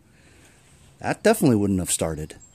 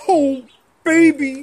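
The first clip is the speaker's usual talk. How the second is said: higher, louder blustery Kramden bellow; Ralph Kramden impression; 10 dB louder, slower than usual. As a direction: belt it out like Kramden. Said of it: "that's like Ralph Kramden".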